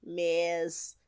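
put-on Australian twang; Australian accent. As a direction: savor the name in an Australian accent. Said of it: "say in Australian accent".

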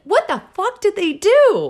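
can't believe what they did; incredulous. Real, not acted. Reacting to Neanderthal dental news, shocked high outburst. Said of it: "disbelief about Neanderthal teeth".

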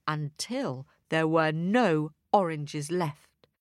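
ordinary delivery

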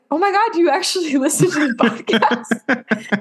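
retold astonishment breaking into laughter; amused disbelief. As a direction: tease him in disbelief, cracking up. Retells her astonished reply.

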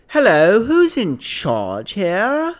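put-on high, drawn-out British accent; British lady. British lady arriving at leasing office, high British accent. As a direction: stretch it out in mock British. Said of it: "She had a British accent".

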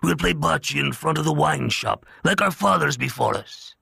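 lightly voiced old don, gentle and reassuring; comforting. A narrator's reading. The Don to his dying friend, comforting. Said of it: "He said comfortingly".

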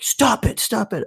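high, hammed-up urgency; retold urgent plea. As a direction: replay yourself breaking up a fight. Counselor breaking up a retold fight.

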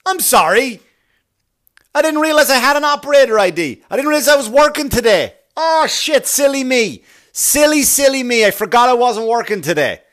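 high, loud mock self-scolding. Worker making a show of forgetting the shift, loudly out loud. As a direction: berate yourself at high volume for all to hear. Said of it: "I start saying this, by the way, out loud".

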